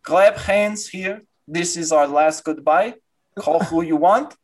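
put-on solemn Russian-accented farewell. Doctor gathering everyone for a last goodbye, Russian accent. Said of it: "very Russian man".

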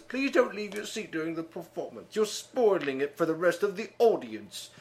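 lady manager voice. Retold or imitated. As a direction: play a prim theater manager. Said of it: "some lady comes off and says".

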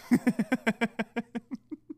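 long run of staccato chuckles; chuckling. Real, not acted. Man cracking up after addressing women, chuckling. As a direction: laugh at your own rant. Own laugh mid rant.

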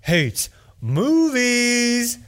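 slow booming announcer voice; drawn-out hype announcer call; fighting game announcer. Acted. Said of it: "Nice announcer voice".

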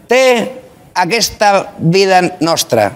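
slow, lofty Catalan line; Catalan switch. Pretend. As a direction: say it in Catalan, grandly.

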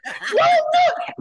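high desperate scream, way up in pitch; frantic panicked scream of no and don't do it; screaming plea. Retold or imitated. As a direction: shriek it like begging for your life. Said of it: "started screaming at the top of his lungs".

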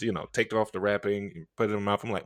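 ordinary talk, nothing marked

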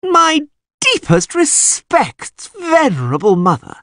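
high, drawn-out mock-reverent whine; hammed-up, high-pitched fawning greeting; stupid whine. A narrator's reading. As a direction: whine it like an exaggerated flatterer. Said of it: "he whined stupidly".

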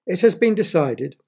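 proud queen's proclamation; royal announcement. A narrator's reading. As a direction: announce it grandly to the court.

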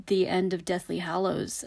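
usual speaking voice

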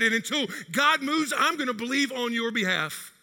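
preaching defiance at Satan, loud and high; defiant rebuke. Acted. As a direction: stand against the enemy, full force.

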